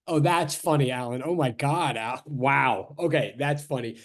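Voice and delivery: low, dry sarcasm; deadpan mock amusement; sarcastic. Real, not acted. Teasing a heckler named Alan.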